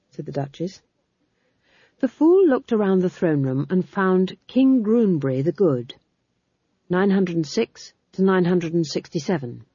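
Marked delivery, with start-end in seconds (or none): none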